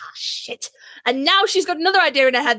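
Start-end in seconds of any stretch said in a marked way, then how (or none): 0.0-0.7 a hushed, breathy "oh shit" under the breath; whispered dread. Retold or imitated. Retelling her reaction to a new fic challenge, whispered dread. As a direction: mutter it like quiet panic. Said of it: "And I was like".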